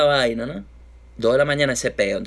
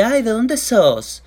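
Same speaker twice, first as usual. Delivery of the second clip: put-on Argentine accent; 9 semitones higher, 3 dB louder than usual. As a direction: coo it like an Argentine girl, pitched way up. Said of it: "Y me dice".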